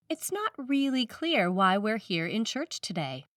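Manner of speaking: ordinary delivery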